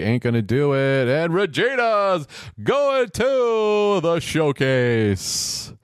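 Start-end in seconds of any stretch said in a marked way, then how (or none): 1.1-5.7 slow, high, drawn-out announcement; mock announcer. Commentator mimicking the host, slow and raised. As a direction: stretch it out like a game show host.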